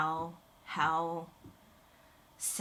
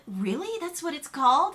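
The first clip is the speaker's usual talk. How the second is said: retold surprise; 8 semitones higher, 6 dB louder than usual. Retelling the Americano surprise.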